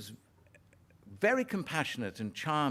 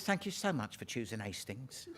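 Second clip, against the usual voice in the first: lightly voiced old lady, softer; 7 dB quieter than usual. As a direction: thank him gently like a little old lady. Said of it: "She says".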